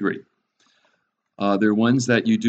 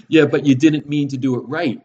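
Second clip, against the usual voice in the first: loud, high-pitched mock kid retort; seven-year-old voice; 6 semitones higher, 8 dB louder than usual. Father mimicking his child in reply to the excuse, seven-year-old voice. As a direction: throw it back like a seven-year-old, louder and higher. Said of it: "in my best seven-year-old".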